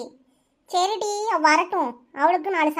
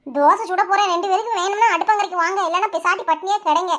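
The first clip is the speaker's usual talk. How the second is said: ordinary talk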